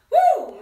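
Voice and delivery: high, loud "woo"; excited whoop. Real, not acted. Teacher cheers the big word metamorphosis, loud whoop. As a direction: whoop in celebration.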